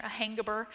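"hangaburger" said for hamburger, "hanga" replacing "ham"; child's mispronunciation. Retold or imitated. Daughter answering what she wants for dinner, mispronouncing hamburger as "hangaburger". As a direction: copy a child's muddled "hangaburger", with ng in the first part. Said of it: "it's so much harder to say it that way".

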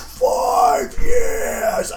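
high cry-shout breaking out; anguished shout. Acted. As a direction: scream the last years.

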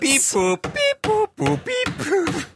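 high beeping robot noises; robot beeps. Pretend. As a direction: beep and boop like a malfunctioning robot. Robot noises derailing the intro.

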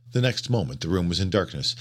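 ordinary delivery, nothing marked

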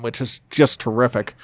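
ordinary talk

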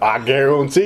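Bayou accent, lilting up at the end; New Orleans accent. Shown as a demonstration. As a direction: put on a hammy Louisiana accent. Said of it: "like a Born in the Bayou accent".

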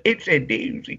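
put-on strong Baltimore accent. Mimicking a local girl at the arena, Baltimore accent. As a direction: lean into the Baltimore vowels on doozy. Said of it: "real strong Baltimore accent".

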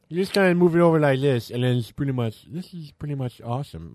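nasal nerdy guy voice; nerd voice. Pretend. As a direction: play a geeky fan. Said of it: "that's my nerd voice".

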